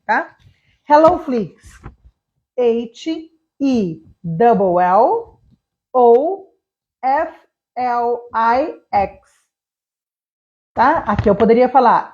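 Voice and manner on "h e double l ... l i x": H E, "double L", O, F L I X, very slow; double L spelling. Shown as a demonstration. Teacher spelling Hello Flix for students, slow double L. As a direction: say "double L" for the repeated letter, spelled slowly. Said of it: "Ou como eu fiz agora com double L".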